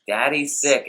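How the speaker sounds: put-on high-pitched mother-to-child voice; wife explaining. Wife explaining Dad to the little son, high mock-mom voice. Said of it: "the wife's like".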